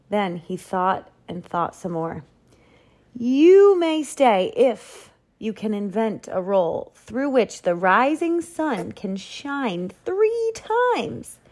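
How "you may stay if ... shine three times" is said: slow, high pronouncement, climbing at the end; grand challenge. A narrator's reading. Duke setting the baker a test, grand challenge. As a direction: draw out the conditions, pitch soaring higher.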